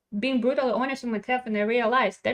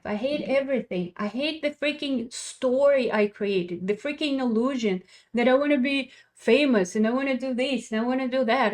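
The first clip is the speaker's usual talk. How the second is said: usual speaking voice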